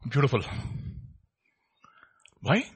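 ordinary talk, nothing marked

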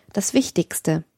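ordinary delivery

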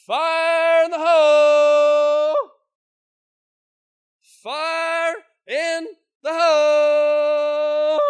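long, drawn-out, very loud high holler; a miner hollering a warning down the tunnel. Acted. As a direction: bellow the warning down the shaft. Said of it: "a miner hollered out".

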